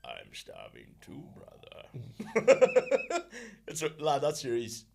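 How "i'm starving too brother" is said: very low, quiet, slow pig croak; pig meme voice. Retold or imitated. Imitating the meme pig, very low and faint. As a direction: breathe it out like a starving pig. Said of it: "those pigs".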